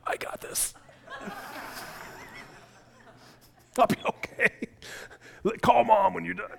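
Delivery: pained, breathy reassurance; dad insisting to his son he can drive, strained and breathy; strained brave face. Retold or imitated. Injured dad waving off the ride, pained breathy voice. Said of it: "And I'm like".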